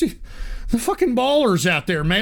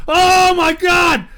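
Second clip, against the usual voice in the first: excited shout; 6 semitones higher than usual. Real, not acted. More donations keep rolling in.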